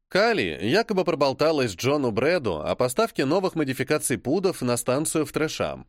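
ordinary delivery, nothing marked

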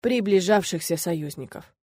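usual speaking voice

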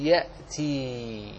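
يأتي drawn out slowly, syllable by syllable; slow model word. Shown as a demonstration. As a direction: dictate يأتي very slowly and low. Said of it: "تقول".

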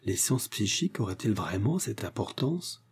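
soft, musing question; an old man's understated murmur; pensive murmur. A narrator's reading. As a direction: ask it quietly, absorbed in thought. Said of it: "murmura le vieillard en s'absorbant dans ses réflexions".

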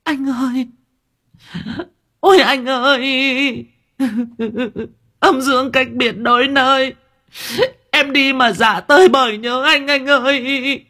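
hammed-up spirit wife wailing, high and trembling; mock wailing. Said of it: "cũng mếu máo".

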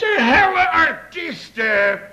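high, loud and drawn-out exclamation; exasperated outburst. Acted. Fed up with jealous guests, loud exasperated cry. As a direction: throw the word artiesten up in exasperation.

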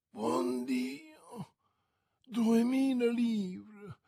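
mild mock horror at the sum; shocked exclaim. A narrator's reading. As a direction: stretch duemila in disbelief. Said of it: "esclamò Madame Coquenard".

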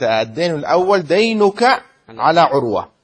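loud, deliberate stress on دينك and على; teacher's emphasis. Shown as a demonstration. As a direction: drill the key words into the class. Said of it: "واضح؟". (تشديد تعليمي بصوت عالٍ)

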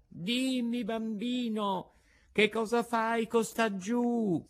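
high, slow, drawn-out call. A narrator's reading. Pigeon calling down to the child, high drawn-out call. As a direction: call down from far above without forcing the volume. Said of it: "gli gridò da una grande altezza".

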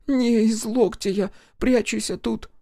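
put-on a scared village girl answering; timid high young girl; frightened girl. A narrator's reading. Frightened girl voiced by narrator.

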